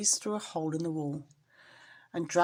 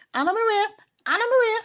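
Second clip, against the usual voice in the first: very high, loud squeaky rat call; squeaking rat; 8 dB louder, faster than usual. Acted. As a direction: squeak her name up high. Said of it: "squeaked the rat".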